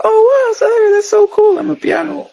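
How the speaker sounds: imitating a delighted foreigner meeting a South African; gushing foreigner. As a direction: squeal it like an overexcited tourist. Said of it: "Either one, like".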